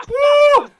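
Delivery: loud high "woo" of celebration; excited whoop. Real, not acted. Streamer celebrates great club news with caller, excited whoop. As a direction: let out a big fan's whoop.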